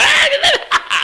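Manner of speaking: short high burst of laughter. Real, not acted. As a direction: laugh along with the room. Laughs with congregation.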